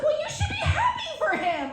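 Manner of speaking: very high, squeaky mock voice; mocking therapist. Imitating her therapist giving advice, mocking high voice. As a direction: mimic a therapist in a silly high pitch. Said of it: "And I tell my therapist, she's like".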